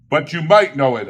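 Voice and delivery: pressing gentleman. Acted. Gentleman pressing Scrooge about the poor, earnest. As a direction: push back politely but firmly.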